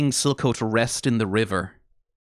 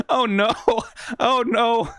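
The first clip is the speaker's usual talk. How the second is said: emotional; 9 semitones higher, slower than usual. Real, not acted. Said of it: "I'm already getting a little emotional".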